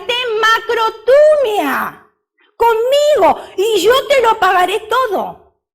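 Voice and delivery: high, loud begging plea, drawn out; pleading servant. Acted. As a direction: beg like a servant on his knees. Servant begs his lord, retold. (súplica aguda y alta de siervo)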